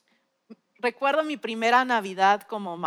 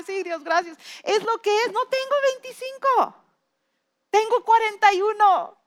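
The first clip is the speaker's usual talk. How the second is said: high, louder grateful cry; fervent thanks; 10 semitones higher, 4 dB louder than usual. Retold or imitated. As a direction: declare it with a rising fervor. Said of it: "Y dije". (agradecimiento ferviente y agudo)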